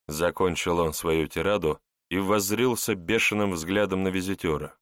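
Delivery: ordinary delivery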